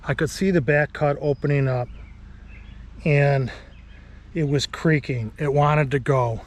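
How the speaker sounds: ordinary delivery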